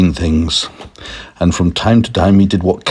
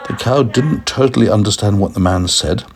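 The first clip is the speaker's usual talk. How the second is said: plain reading voice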